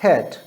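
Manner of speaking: हैट said short, open "a"; short-vowel example हैट; short A sound. Shown as a demonstration. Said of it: "ए की sound ए आती है".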